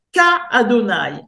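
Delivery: "Ka Adonai" with a long, stretched "Ka"; K reading. Shown as a demonstration. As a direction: draw out the "Ka" before "Adonai". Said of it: "si je le lis avec le K". (« Ka » allongé avant « Adonai »)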